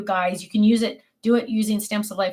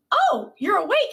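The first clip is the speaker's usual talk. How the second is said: startled; 9 semitones higher than usual. Retold or imitated. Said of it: "And I'm like".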